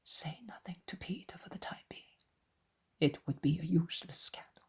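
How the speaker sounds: soft, secretive murmur; hushed, confidential warning; whisper. A narrator's reading. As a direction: whisper it privately to a companion. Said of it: "whispers again through his".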